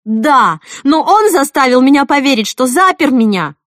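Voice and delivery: loud, high, frantic protest; hysterical explaining. A narrator's reading. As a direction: insist desperately, near hysteria. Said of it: "как истерический бред".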